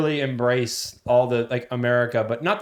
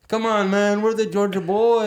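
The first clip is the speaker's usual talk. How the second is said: higher, slow mock Eastern European accent; 8 semitones higher than usual. Imitating his Eastern European friend's catchphrase in the accent. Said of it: "in his Eastern European accent".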